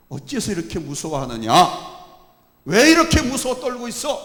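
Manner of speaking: high, loud scolding as Jesus; rebuking frightened disciples, rising to a shout; rebuke. Acted. Jesus scolds the frightened disciples, high and loud. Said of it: "오히려 책망을 하셨습니다".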